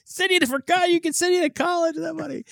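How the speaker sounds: high-pitched mock-incredulous parent; mock exasperation. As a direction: squeal it in playful disbelief, voice way up high. Voicing own exasperated reaction to daughter.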